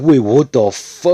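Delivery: high, loud, slowed-down shocked "wait, what"; shocked surprise. Retold or imitated. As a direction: blurt it out like you just got stabbed. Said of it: "And he says, like".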